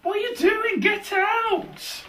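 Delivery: high squeaky outraged voice; shrill intruder voice. Acted. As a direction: squeal it like a startled bather. Someone barges in on bath.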